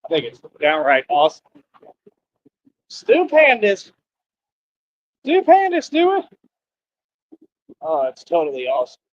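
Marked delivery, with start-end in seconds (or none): 2.9-3.9 high, slow, drawn-out call of the name; sing-song name call. Acted. Vlogger calling out to Stu Pandas, high slow sing-song. 5.2-6.2 much higher, quicker squeal of the name; squeaky name call. Acted. Vlogger squealing Stu Pandas again, even higher and quicker.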